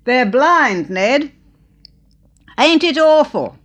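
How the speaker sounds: high, loud aghast cry; loud dismay. Acted. Old lady to husband Ned, loud, high dismay.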